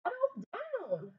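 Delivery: high, soft, slow plea; calm him softly; weary mom. Acted. Mom telling David to settle down. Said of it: "Mom says".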